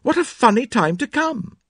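put-on high-pitched girlish astonishment; surprised exclamation. A narrator's reading. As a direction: react to an untimely visitor, voice way up. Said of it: "exclaimed Cynthia".

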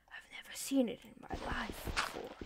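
hushed amazed whisper; awed whisper. Pretend. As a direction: whisper in wonder at the strange water. Amazed at zero gravity water.

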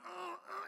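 put-on high, soft toddler groans; imitated straining groan. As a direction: mimic a baby with a bellyache. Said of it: "he's making these noises".

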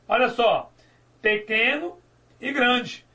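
ordinary talk, nothing marked

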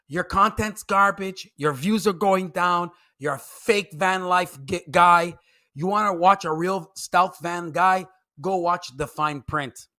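ordinary talk, nothing marked

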